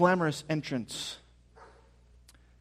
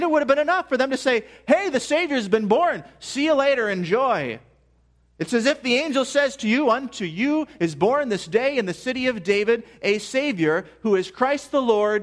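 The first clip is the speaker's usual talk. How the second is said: higher, louder preacher build; fervent sermon delivery, climbing; rising preaching; 7 semitones higher, 3 dB louder than usual. Acted. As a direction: lift the congregation with the angel's news. Rising sermon retelling the angel.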